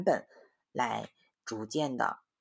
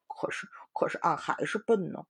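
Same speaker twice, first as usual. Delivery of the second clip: mumbling sulky pig; 4 semitones higher than usual. Acted. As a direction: mutter sulkily about being dumb. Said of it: "嘟哝着". (猪八戒小声嘟囔)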